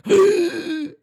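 sharp high horrified gasp; shocked gasp. Retold or imitated. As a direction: gasp in horror. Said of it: "he was like".

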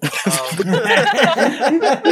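helpless amused laughing; laughter. Real, not acted. Co-host laughing at the reader's regret, laughter. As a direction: burst of high laughter.

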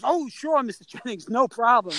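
high, mock-eager compliance; suddenly obliging. Guard giving in to famous news anchor, mock eager-to-please. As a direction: imitate a guard falling over himself to agree.

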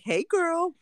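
high, sweet mock "Hey, girl"; sugary fake greeting. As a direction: chirp it up like a fake friend.

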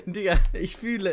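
laughing through the words. Real, not acted. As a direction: laugh while saying it. Laughing while agreeing.

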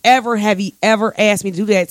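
ordinary delivery

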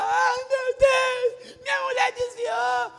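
hammed-up high, slow lament; mock wailing husband. Pastor mocks how he won't cry for his wife, hammed-up wail. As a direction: whine like a heartbroken husband. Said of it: "não pense que eu vou ficar".

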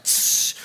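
sharp voiceless "tsh" splash noise; imitated water splash. Buddy dousing the runner with water, imitated splash. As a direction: make a hissing splash sound effect.

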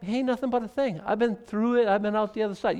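a hint of an unbothered Jesus; casual Jesus voice. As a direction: shrug it off like it's nothing. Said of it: "And he's like".

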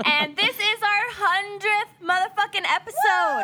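high, thrilled and laughing; excited. Real, not acted. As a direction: celebrate the milestone. Celebrating hundredth episode.

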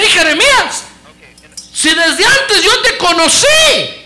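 high, loud, slow call of God; booming call. Acted. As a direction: call him out with full force. God calling, preaching climax.

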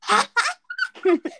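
loud high laugh; high burst of laughter. Real, not acted. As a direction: let out a bright laugh. Laughs after being killed.

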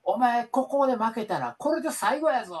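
高めの声で檄を飛ばすセコンドの真似; 選手に脅すように声を張る; 凄むセコンド. Retold or imitated. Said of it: "ちょっとなんで関西弁なん？". (選手を叱るセコンドを真似して、高い声で凄む)

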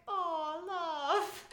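lightly voiced Liverpudlian man; [name] impression. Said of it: "And he's just like".

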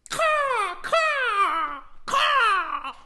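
high, loud cawing crow; squawking crow calls; crow caws. Acted. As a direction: caw like a bird. Playing a crow.

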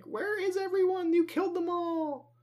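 very high-pitched yell without real volume; imitated hysterical accusation, high and contained; mock yelling. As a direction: squeal the accusation as a held-back comic yell. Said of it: "Billy was basically just yelling at them".

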